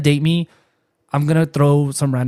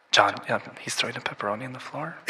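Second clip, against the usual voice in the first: softer deadpan aside into store mic; Walmart announcer; 3 semitones lower, 9 dB quieter than usual. Pretend. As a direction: report it over the intercom. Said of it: "be, like, the Walmart guy".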